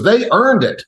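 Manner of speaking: put-on high, firm little boy; child's verdict. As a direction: state it like a kid who is sure. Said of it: "He goes".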